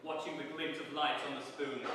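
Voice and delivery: usual speaking voice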